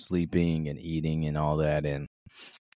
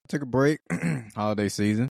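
ordinary delivery

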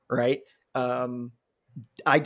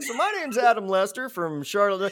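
mock stand-up intro; 7 semitones higher than usual. Mocking friend's stand-up opening.

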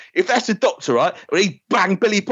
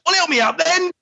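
high, impatient mock voice; high mock grumble; grumpy Ben; 13 semitones higher than usual. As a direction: demand it grudgingly. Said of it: "Ben goes".

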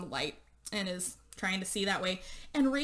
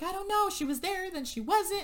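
a lot higher, mock panicked; frantic; 9 semitones higher than usual. Lana telling Shane that Rachel vanished, high and frantic. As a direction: voice the scared girlfriend shrilling back.

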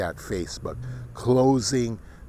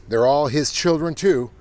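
ordinary delivery

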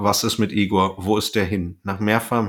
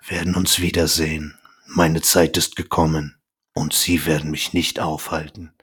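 deep villain's promise; imitating Dracula; 6 semitones lower than usual. Dracula vowing to Van Helsing, low and menacing. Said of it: "ich versuch's einfach mal".

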